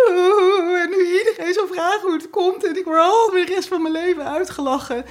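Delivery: high, tearful wailing; crying. Acted. Wife with sausage on her nose laments her fate, high tearful crying. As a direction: wail it like a weeping wife. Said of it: "ze begint te huilen".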